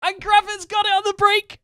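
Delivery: very high, loud commentary yell; excited shout. Real, not acted. Player calling the game's attack, excited shout. Excited call during game action.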